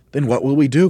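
high, loud, alarmed question; Mugglewump's cry. A narrator's reading. Mugglewump fears winter in the treehouse, crying out. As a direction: cry out for an answer. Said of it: "cried Mugglewump".

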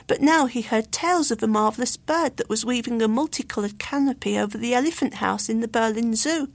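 usual speaking voice

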